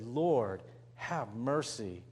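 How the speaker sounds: humble plea. Acted. Preacher giving the true prayer for mercy, low and slow. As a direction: pray it quietly and humbly. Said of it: "He said".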